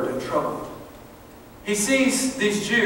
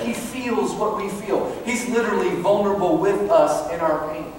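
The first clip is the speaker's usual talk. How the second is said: ordinary talk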